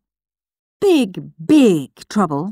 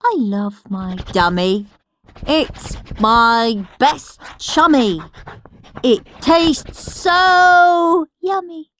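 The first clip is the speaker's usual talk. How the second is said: mocking baby poem; 5 semitones higher than usual. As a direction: sneer through a silly baby rhyme.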